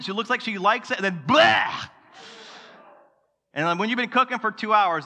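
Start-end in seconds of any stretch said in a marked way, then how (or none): 1.3-1.8 spitting "blah" out loud; a blown raspberry of disgust; comic gagging noise; mock disgusted sputter. Wife's reaction to spicy food.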